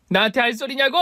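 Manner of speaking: furious repeat. A narrator's reading. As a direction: throw the question back even harder.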